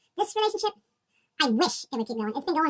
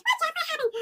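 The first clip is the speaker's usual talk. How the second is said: mock eager friend; 13 semitones higher than usual. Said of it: "here's an example".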